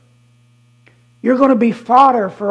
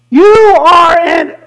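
loud slow shouted rebuke; shout at devils; 5 semitones higher, 8 dB louder than usual. Retold or imitated. Preacher confronting devils with a slow shout. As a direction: bark it at the devils. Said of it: "I'm saying to the devils".

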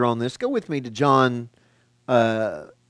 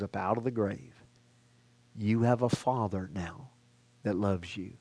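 much softer, low-pitched voice; tender reassurance; 5 semitones lower, 9 dB quieter than usual. Real, not acted. Preacher telling congregation they are loved, tender reassurance. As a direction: let it sink in gently, low and hushed.

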